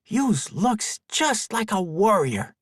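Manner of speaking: put-on high, slow, awestruck little boy; a wide-eyed kid marvelling; child voice. Awed boy character speaks.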